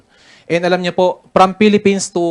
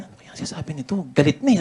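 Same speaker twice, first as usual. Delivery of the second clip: much softer nervous aside; softly wondering if the officer is angry; worried aside; 6 dB quieter than usual. Retold or imitated. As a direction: mutter it so the officer can't hear. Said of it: "hindi ko maintindihan".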